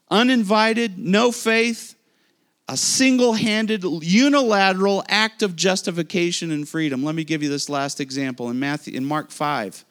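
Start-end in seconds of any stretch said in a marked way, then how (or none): 0.1-7.0 louder, higher preaching build; rising preaching. Acted. Preacher proclaims God acts uninvited, louder and higher. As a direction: lift the congregation with each phrase.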